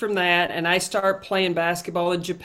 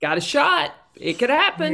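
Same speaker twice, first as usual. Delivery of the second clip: higher-pitched, hopeful game call; upbeat commentator; 7 semitones higher, faster than usual. Retold or imitated. Commentator late in a close game, upbeat and hopeful. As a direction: pitch it up like there's still a chance. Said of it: "when I'm commentating games".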